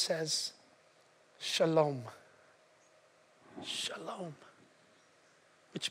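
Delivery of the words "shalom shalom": low, very soft and unhurried; calming hush. Retold or imitated. Jesus to the crashing waves, soft calming hush. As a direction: soothe the storm with a hushed word.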